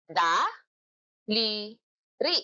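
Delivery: "Daliri" stretched very slowly, syllable by syllable; very slow syllabified "Daliri". Shown as a demonstration. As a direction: draw each syllable out. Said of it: "Ilang pantig?".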